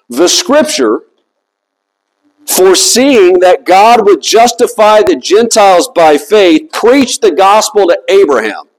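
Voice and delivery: deliberate reading with long pauses; slow reading. Shown as a demonstration. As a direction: read it slowly, letting each phrase stand. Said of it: "I'm gonna read this slowly".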